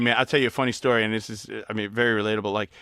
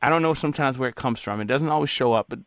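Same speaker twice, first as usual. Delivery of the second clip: normal talking voice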